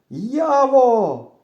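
high, loud drawn-out call. A narrator's reading. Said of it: "кричу я трохи голосніше".

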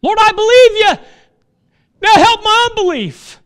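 high, loud cry to the Lord. Retold or imitated. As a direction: cry it out like a desperate prayer. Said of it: "I cried out the same thing".

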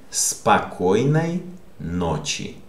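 "Спокойной ночи" slow, with each word joined up; slow whole phrase. Shown as a demonstration. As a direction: say "Спокойной ночи" slowly as two whole words. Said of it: "Repeat it again".

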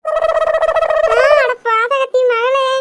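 high gasp then a slow, drawn-out scold; shocked outcry. Acted. Mother caught by her daughter, slow shocked outcry. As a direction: caught out, she gasps and drags out the insult.

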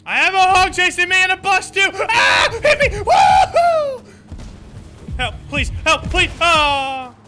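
panicked yelling while fleeing; frantic cries for help; being chased. Real, not acted. Chased by hog and bus.

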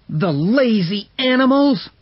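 high, loud scornful outburst; young man scolding his dogs, high and loud; angry shout. Acted. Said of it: "shouted Hal".

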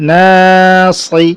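slow, level, sustained first vowel in "নাসি"; stretched reading. Shown as a demonstration. Teacher reading through for students, "না" drawn out. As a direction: hold "না" long in "নাসি", then short "সি". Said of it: "টানা পড়ে চলে যাবো".

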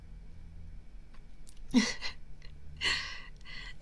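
a quiet, voiceless little laugh; hushed giggle. Acted. Pleased with the apology, a hushed voiceless giggle. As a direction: giggle under the breath, almost silent.

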